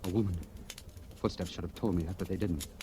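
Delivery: soft, dropped, hushed telling; hushed recollection. Acted. Man confessing to lieutenant how he froze, hushed. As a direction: pull the voice down to a hush.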